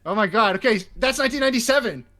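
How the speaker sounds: loud, high-pitched astonishment; shocked exclamation. Real, not acted. Co-host reacting to the actor's photo, loudly shocked. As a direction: burst out loudly in disbelief.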